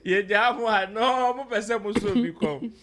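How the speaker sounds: words bursting through loud laughter; laughing while talking. Real, not acted. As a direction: read it while you can't stop laughing. Host reads messages, cracking up.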